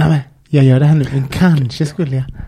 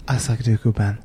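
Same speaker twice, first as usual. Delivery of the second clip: low, soft, confidential shopkeeper; low, soft saleswoman murmur; confiding saleswoman; 6 semitones lower, 10 dB quieter than usual. Retold or imitated. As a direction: lean in and drop the voice like a saleswoman sharing a secret. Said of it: "Hon bara".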